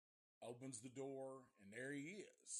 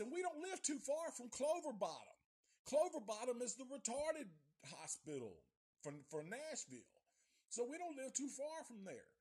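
put-on scared kid's rant; 10 semitones higher, 5 dB louder than usual. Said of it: "That's what we're thinking".

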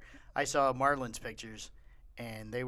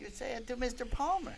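much higher, childlike imitation; kid voice; 9 semitones higher, 6 dB quieter than usual. Cohost voicing the son about his teacher, childlike. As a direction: pipe up like a small boy.

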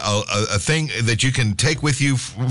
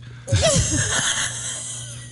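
high burst of laughter; laughing hard; 4 dB quieter than usual. Real, not acted. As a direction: crack up laughing. Group cracking up at joke.

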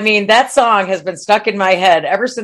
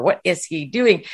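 ordinary talk, nothing marked

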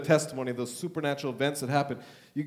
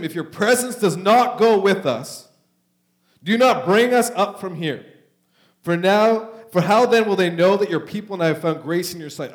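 scripture reading; 5 semitones higher, 10 dB louder than usual. Acted. Preacher reading Moses' plea to the church, loud and proclaiming. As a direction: proclaim the verse to the congregation.

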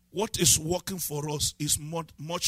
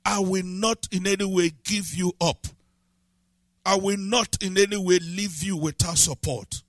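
ordinary talk

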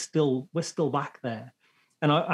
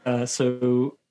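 breaking up over bad connection; glitching audio; 3 dB louder than usual. Real, not acted. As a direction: voice cutting out online. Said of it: "Oh, you've gone out on me".

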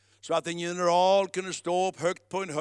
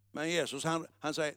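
normal talking voice

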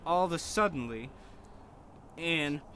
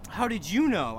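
mock surprise; 6 semitones higher, 3 dB louder than usual. A narrator's reading. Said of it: "I asked in a surprised way".

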